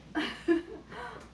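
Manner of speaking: a laugh slips out; stifled laugh. Real, not acted. Narrator breaking into a stifled laugh.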